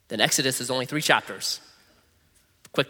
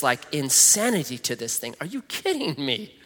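ordinary delivery

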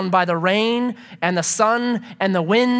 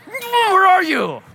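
startled awake; 11 semitones higher, 7 dB louder, much slower than usual. Retold or imitated.

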